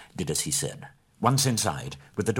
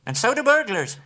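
much higher, teasing hobbit voice, louder at the end; cheeky parting shot; 14 semitones higher, 5 dB louder than usual. A narrator's reading. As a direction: toss off a sly jab as he runs. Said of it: "as a parting shot".

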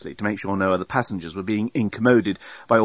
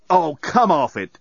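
slow, higher-pitched exasperation; exclaiming; 6 semitones higher, 4 dB louder than usual. A narrator's reading. Dan protesting the doorbot's restrictions, exclaiming. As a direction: scoff it out, stretched and raised. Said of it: "exclaimed Dan".

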